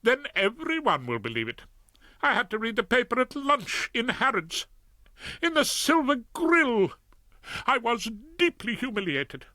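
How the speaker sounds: wife telling husband of her humiliation; lightly voiced lady. A narrator's reading. Lady Featherstone complains to husband.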